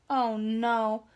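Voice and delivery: plain reading voice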